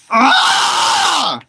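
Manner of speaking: sharp high yell of pure frustration; frustrated cry-shout. Real, not acted. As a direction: let out a real angry cry. Raging at Minecraft Nether game.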